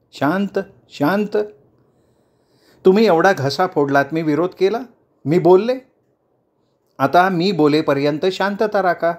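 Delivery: a hint of a politician quieting a rowdy crowd; leader calming a crowd. Chandrakala calms the crowd.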